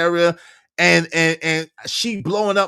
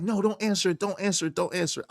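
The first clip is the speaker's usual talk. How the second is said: hushed pleading; 8 dB quieter, faster than usual. Retold or imitated. Wife pleading quietly.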